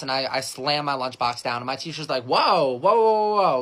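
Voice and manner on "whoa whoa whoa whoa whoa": high, loud string of startled whoas; alarmed teacher. Acted. Teacher stopping kid who slammed lunchbox, alarmed. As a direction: halt someone in their tracks, acted.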